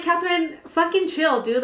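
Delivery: high-pitched, exasperated pushback; acted-out irritated comeback; exasperated retort. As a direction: retell it as an exasperated plea to calm down. Retold retort in a quarrel.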